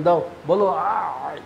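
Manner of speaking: put-on slow coaxing to a little child. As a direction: gently prompt a small child to say it. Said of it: "ওকে যখন বলবেন". (বাচ্চাকে ধীরে ধীরে শেখানো)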